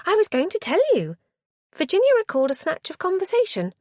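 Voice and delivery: put-on high, bright eager girl voice; eager young niece. A narrator's reading. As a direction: play the keen niece, rushing.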